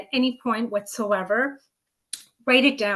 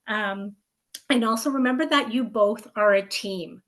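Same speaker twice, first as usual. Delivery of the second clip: usual speaking voice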